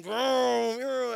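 playful vroom of a jet ski; engine noise imitation. Speaker mimics the lifeguard's jet ski engine, playful. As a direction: mimic the motor coming in.

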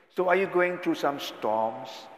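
usual speaking voice

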